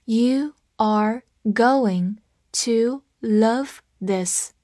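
very slow, each word separate; slow read-along. Shown as a demonstration. Teacher has learners follow along, slow read-along. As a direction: read it one word at a time, slowly. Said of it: "跟我慢读一遍".

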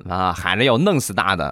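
usual speaking voice

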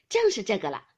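usual speaking voice

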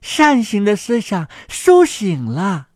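plain reading voice

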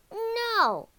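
high, loud cry of refusal; shout. A narrator's reading. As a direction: shout it in dismay. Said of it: "shouted Hansel".

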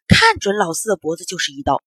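ordinary delivery

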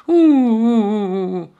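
put-on long, high wailing "wuu" of an old man; mock crying. A narrator's reading. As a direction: act out a drawn-out tearful wail. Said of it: "那老人哭了起来". (夸张地拖长声呜呜哭)

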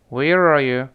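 "Where are you" run together, "you" weakened to "ya"; linked, weakened words. Shown as a demonstration. Teacher leads students in the first three words, linked and weakened. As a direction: glide through "Where are you" as one word, ending in "ya". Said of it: "体会一下这个口型和声音".

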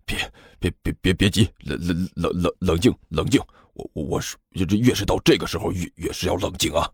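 low, hushed stutter; dry, stammering nervousness. Acted. Director urges calm while sweating, stammering. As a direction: try to sound calm while stumbling on words. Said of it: "徐主任干巴巴地说道".

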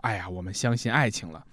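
a hint of a gushing fan; mock fan. Host mimics fans believing in love, mock sigh. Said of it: "粉丝们说".

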